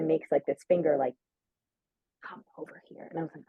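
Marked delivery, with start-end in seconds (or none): 2.2-3.1 whispered imitation of a discreet summons; hushed beckoning. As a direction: mimic someone whispering them over. Beckoning her over discreetly in story.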